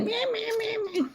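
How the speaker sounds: put-on high soft baby meow. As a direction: squeak it like a tiny kitten. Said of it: "He's like".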